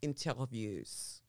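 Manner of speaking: put-on French-accented interviews; mock French. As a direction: say interviews the French way, slow and soft. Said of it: "as they like to say in France".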